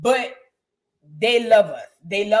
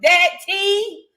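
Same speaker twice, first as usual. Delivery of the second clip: higher, slow sassy gloat; 7 semitones higher, slower than usual. Retold or imitated. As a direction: savour every word, rubbing it in. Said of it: "I turned around and said".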